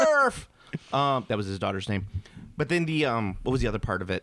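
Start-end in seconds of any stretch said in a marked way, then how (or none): 0.0-0.5 high, loud mock southern cry; exaggerated southern holler; Matthew McConaughey's southern accent. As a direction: call it out in a hammed-up southern accent. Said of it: "you have a southern accent".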